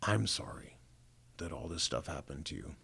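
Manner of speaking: hushed, tearful retelling; soft, half-whispered apology, imitating a hurt mother; through tears. Mom apologising to her son for what happened, quietly tearful. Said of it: "she, through tears, she said".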